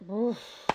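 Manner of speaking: acted "oof" on impact; impact grunt. Isaac crashes to the floor, grunting on impact. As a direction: grunt as if knocked over.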